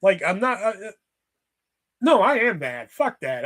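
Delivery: ordinary talk, nothing marked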